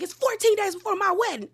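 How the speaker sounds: high-pitched imitation of an indignant friend; hammed-up protest, voice shooting up; mock outburst. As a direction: mimic someone losing it in an argument. Acts out friend's outburst in quarrel.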